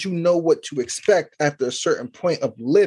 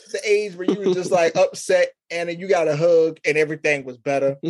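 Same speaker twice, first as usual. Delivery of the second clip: ordinary talk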